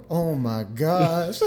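slow, drawn-out mock groan of words; imitating slapped guy. Imitating the guy getting slapped in the video, drawn-out mock reaction. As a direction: stretch each word out as an imitation. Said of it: "the guy that getting slapped and then going".

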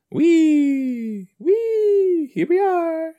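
very high gleeful wee; high delighted cartoon squeal. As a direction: squeal like a kid on a swing. Voicing characters swinging.

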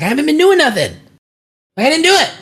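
high-pitched imitation of a kid pleading innocence; protesting kid. Kid denying it to his mother, mock protesting.

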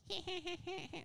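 high-pitched cartoon goblin giggle; staccato squeaky mischievous laugh; Timmy Turner. Goblins cackling in the background, high cartoon giggle. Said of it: "They're doing the Timmy Turner voice".

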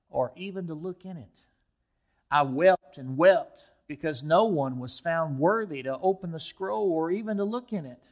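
preacher reading Revelation five to the church; scripture reading. Acted. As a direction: read the vision with weight and build.

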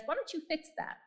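lightly voiced innocent little girl; child's question. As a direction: ask it like a curious toddler. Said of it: "And then she says".

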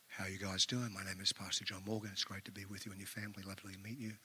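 soft, low, hushed consoling tone; pastor voice. Retold or imitated. Pastor greeting a grieving family, soft low consoling voice. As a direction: ease in gently, like comforting the grieving. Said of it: "there's a tone of your voice you have to use".

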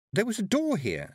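high, lightly voiced young girl; polite girl asking up high. A narrator's reading. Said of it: "said Tiffany".

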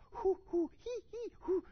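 high breathy hoo hee panting; mock labor breaths, high; Lamaze breathing. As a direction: imitate the class's breathing drill. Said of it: "they teach you".

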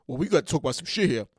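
deadpan insistence; low flat writer voice. Pretend. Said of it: "the two alter egos".

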